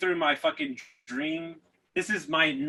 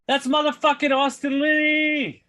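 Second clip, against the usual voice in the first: yelling across the street; 12 semitones higher, 6 dB louder than usual. Retold or imitated. Stranger calling out across the street, loud yell. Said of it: "somebody yells out across the street".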